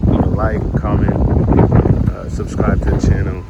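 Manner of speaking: loud outro over wind noise; shouting over wind. Real, not acted. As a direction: raise your voice over the wind. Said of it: "sorry about the wind noise".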